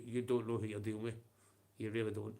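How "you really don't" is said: usual speaking voice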